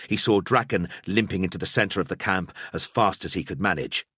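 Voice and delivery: ordinary delivery, nothing marked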